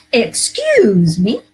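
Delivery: drawn-out, emphatic Excuse me; demo phrase again. Shown as a demonstration. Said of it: "Notice what you notice".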